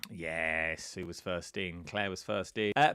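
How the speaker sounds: ordinary talk, nothing marked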